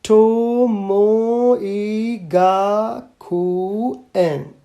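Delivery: drawn-out, very slow spelling-out of the name; slowly read sign. Acted. Little girl reading the school sign aloud, very slowly. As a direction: sound it out word by word like a child reading.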